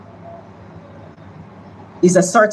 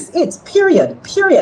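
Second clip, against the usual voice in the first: high, insistent "period" twice; emphatic finish; 11 semitones higher than usual. Real, not acted. MP insists to the host she's won, pitched high. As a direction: slam the point shut. MP clinches her point.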